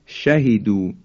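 normal talking voice

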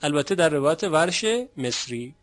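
usual speaking voice

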